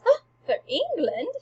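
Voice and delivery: exaggerated fake astonishment; feigned surprise. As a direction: act astonished, and let it sound put on. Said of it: "And Hamlet feigns surprise".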